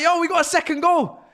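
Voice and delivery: quick, shouted hyped-up fan; mock hype. Fan imitating an overexcited rival player, quick shout. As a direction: rattle it off like a buzzing rival. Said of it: "He's like".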